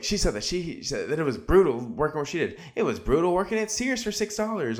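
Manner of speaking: high, whiny mocking imitation; mocking Sai. Podcaster mocking Sai's complaint about working at Sears, high whiny imitation. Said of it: "Sai's like".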